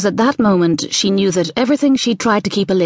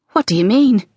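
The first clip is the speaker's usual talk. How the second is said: higher, mildly anxious question; trembling voice; 5 semitones higher than usual. A narrator's reading. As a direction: ask it with a touch of fear. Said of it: "Her voice trembled".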